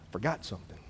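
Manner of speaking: dropped low and soft, almost under the breath; soft, offhand mock remark; hushed aside. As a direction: toss it off quietly as an afterthought. David looks back, quoted.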